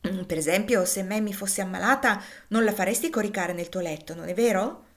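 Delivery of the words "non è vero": usual speaking voice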